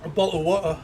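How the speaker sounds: a mock British bottle of water; British accent. Shown as a demonstration. Streamer saying bottle of water to chat in a put-on British accent. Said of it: "wrote bottle in the accent".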